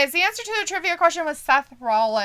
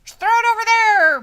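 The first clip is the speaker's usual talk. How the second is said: higher, louder careless toss-off; mock wrestler; 9 semitones higher, 8 dB louder than usual. Voicing the wrestler throwing the flag aside.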